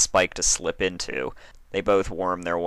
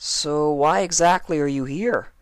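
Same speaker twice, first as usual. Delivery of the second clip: Spike's voice; 10 semitones higher, 3 dB louder than usual. A narrator's reading. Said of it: "Spike queried openly".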